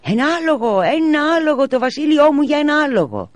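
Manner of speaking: put-on high child's shouting voice; a boy crying out while playing horse. A narrator's reading. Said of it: "φώναζε". (ψηλή παιδική φωνή που φωνάζει)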